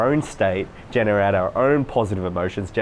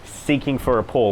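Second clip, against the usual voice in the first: usual speaking voice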